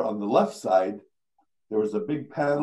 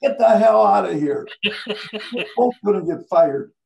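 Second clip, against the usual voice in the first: louder, higher exasperated outburst; angry retort; 7 semitones higher, 6 dB louder than usual. Retold or imitated. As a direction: throw him out in alarm. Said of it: "He said".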